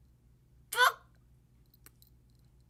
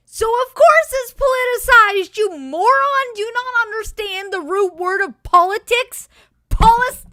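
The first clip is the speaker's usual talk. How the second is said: loud, high exasperated rant; ranting at a politician's logic; angry rant; 11 semitones higher, 10 dB louder than usual. Real, not acted. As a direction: tear into him, louder and higher. Ranting at a dumb argument.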